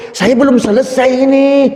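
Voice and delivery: high-pitched indignant snap, half-acted; indignant scolding. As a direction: scold like an annoyed mother who isn't finished. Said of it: "Dimarahin habis-habisan". (omelan tinggi yang kesal)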